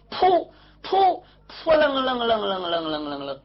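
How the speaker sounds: rapid rolling flutter sound; wing flutter imitation. Bird taking off from the treetop, imitated flutter. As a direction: trill the wingbeats with the tongue.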